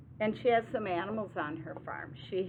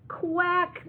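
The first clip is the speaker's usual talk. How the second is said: high, loud quack; hammed-up duck complaint; moaning duck quack; 11 semitones higher, 8 dB louder than usual. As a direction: quack in protest like a storybook duck. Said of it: "the duck moans".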